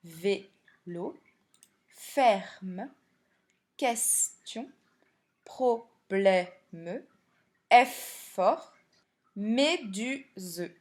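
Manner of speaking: "Vélo, ferme, question, problème, effort, méduse" in separate syllables. Shown as a demonstration. Teacher showing where syllables end, "Vélo, ferme, question, problème, effort, méduse" split by syllable. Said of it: "pour terminer la syllabe".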